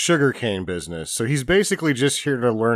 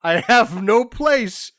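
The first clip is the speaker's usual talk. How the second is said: higher, slower mock panic; imitating a flustered goat thief; mocking the neighbor; 9 semitones higher, 3 dB louder, slower than usual. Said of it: "because you're like".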